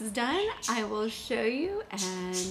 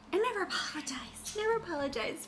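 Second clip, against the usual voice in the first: breathy secret whisper, higher; 8 semitones higher than usual. Real, not acted. Baking host whispers a little rule to viewers, whispered. Whispered aside while cooking.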